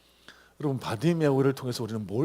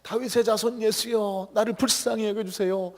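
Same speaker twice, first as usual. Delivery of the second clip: pleading cry, higher and slowed; crying out to Jesus; 7 semitones higher than usual. Retold or imitated. Blind beggar calling out to Jesus, pleading cry. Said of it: "예수님을 소리쳐 불렀습니다".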